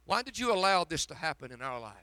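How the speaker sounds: normal talking voice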